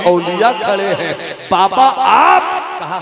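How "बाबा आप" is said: high, loud, drawn-out question; astonished. Acted. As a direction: gasp it out in surprise, stretching आप. Startled woman sees the saint. (हैरानी से ऊँची आवाज़ में)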